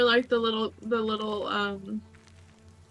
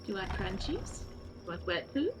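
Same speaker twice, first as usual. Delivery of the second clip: soft pet talk; 9 dB quieter than usual. Real, not acted. Talking to the cat.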